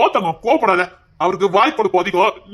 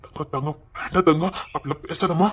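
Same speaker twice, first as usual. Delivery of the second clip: hushed pleading; 5 semitones lower, 7 dB quieter than usual. Acted. Begging daughter to stop crying.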